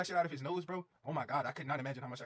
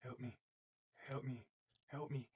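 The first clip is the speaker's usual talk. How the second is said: softer, faint mock pleading; weak repeated plea; 3 semitones lower, 6 dB quieter, slower than usual. Imitating a slumped friend over the trash can, faint pleading. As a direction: murmur it like a wrecked friend. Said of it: "he just kept saying".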